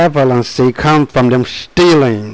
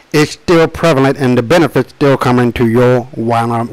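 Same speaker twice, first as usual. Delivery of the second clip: ordinary delivery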